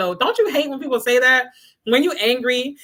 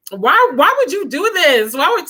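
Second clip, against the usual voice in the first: exasperated; 7 semitones higher, 3 dB louder than usual. Retold or imitated. Exasperated quote of herself to dudes.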